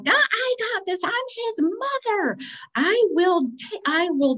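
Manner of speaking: lampoon her own bravado; mock confident. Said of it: "And I'm like".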